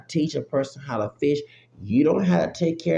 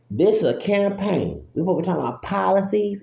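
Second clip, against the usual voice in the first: Ann Coulter voice; 5 semitones higher, faster than usual. Retold or imitated. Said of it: "in my Ann Coulter voice".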